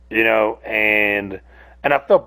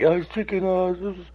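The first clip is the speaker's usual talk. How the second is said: higher, lightly put-on onlooker voice; mock the audience's guess in a higher pitch; mild mock amazement, pitched up; imagined viewers; 8 semitones higher than usual. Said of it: "And y'all think".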